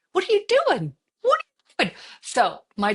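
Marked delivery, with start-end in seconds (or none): 0.1-1.0 much higher, self-scolding yelp; high mock panic. Mom to herself in the parking-lot plan, high panicked yelp. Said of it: "I'm like". 1.2-2.0 much higher, louder, crying shriek; mock crying wail. Mom scolding herself over the concert, crying wail. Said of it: "I'm like".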